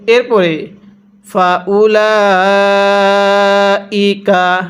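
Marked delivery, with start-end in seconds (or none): 1.3-4.6 ফাউলাইকা with lam held four alif long, very slow; slow Quranic chant; four-alif stretch. Shown as a demonstration. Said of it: "লামের মধ্যে আমরা চার আলিফ টান দিব".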